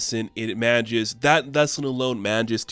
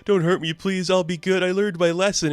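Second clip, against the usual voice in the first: high, pleading fake-kid sobbing; mock crying child; 7 semitones higher, 4 dB louder than usual. Damien fakes tears so Krampus spares him, mock crying. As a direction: ham up a child begging not to be hurt. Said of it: "So he starts crying".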